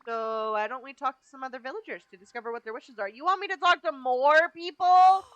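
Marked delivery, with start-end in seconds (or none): 3.1-5.2 exasperated. Real, not acted. Exasperated at game request.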